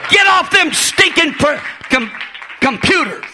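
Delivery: loud, high shouted sermon; preaching at full force. Acted. Pastor shouting at the young people, preaching.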